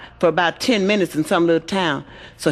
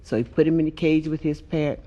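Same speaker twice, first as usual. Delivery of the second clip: ordinary talk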